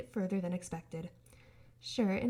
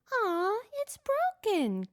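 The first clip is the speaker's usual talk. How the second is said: very slow, much higher disappointed voice; sad high robot voice; 12 semitones higher, 5 dB louder, much slower than usual. A narrator's reading. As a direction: whine like a sad child. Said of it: "Gur said".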